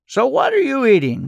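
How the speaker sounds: lightly voiced old man, leading the boy on; playful quizzing. A narrator's reading.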